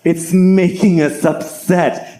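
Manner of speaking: slow, drawn-out nerd whine; mock nerds lamenting, stretched out; upset nerdy geniuses. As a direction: play an aggrieved egghead, slowly. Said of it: "all the nerdy geniuses that studied for years are like".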